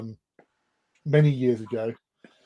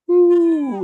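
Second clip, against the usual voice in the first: high, drawn-out falling "ooh"; cartoon falling whistle; 14 semitones higher, 4 dB louder than usual. Imitating Wile E. Coyote looking over the cliff, cartoon falling whistle. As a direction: imitate a long cartoon plummet. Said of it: "Wile E".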